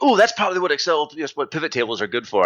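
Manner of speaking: high-pitched excited "ooh", then settling; light up as the idea hits; sudden realization. Retold or imitated. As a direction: start high and eager, easing down. Acted moment of sudden realization.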